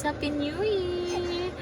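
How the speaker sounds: high, soft, stretched-out greeting; festive soft cheer. Real, not acted. Greeting viewers in the Philippines, softly festive and drawn out. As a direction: draw out YEAR in a small high cheer.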